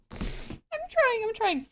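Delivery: high, squeaky effort through held-in giggles; stifled laughter. Real, not acted. Trying to read with a straight face, stifled laughter. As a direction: fight back the laugh, high-pitched.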